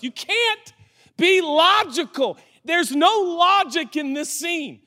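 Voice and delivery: high-pitched, insistent preacher's appeal; impassioned preaching. Real, not acted. Preacher pleading with church to accept mystery, impassioned. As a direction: preach it high, driving each point home.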